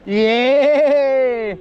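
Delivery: joyful yell; victory whoop. Real, not acted. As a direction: cheer the win out loud. Cri de victoire au sommet. (cri de joie)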